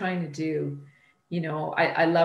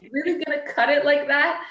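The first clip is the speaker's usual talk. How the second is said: skeptical teasing; 10 semitones higher, 4 dB louder, faster than usual. Retold or imitated. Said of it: "peeking into the kitchen".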